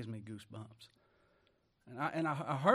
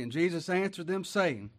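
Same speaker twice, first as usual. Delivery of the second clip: raised, ringing pulpit reading; preaching scripture; 4 semitones higher, 4 dB louder than usual. Acted. Preacher reading the verse aloud to his church, ringing. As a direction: read the verse out to the congregation.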